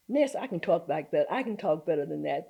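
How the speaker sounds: ordinary talk, nothing marked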